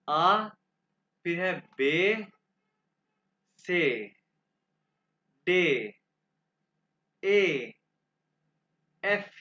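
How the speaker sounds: loud, isolated letter names from "आ" to "एफ"; German alphabet demonstration. Shown as a demonstration. Teacher pronouncing the alphabet for students to repeat, emphatic model. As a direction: call out each German letter, long pause after each. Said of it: "मैं आपके सामने दो दफ़ा pronounce करूंगा".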